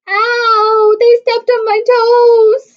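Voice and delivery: high, loud, slow sing-song squeal; a little bear squealing as hooves stomp his toes. A narrator's reading. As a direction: squeal it out in pain like a baby bear. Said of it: "squealed Baby Bear".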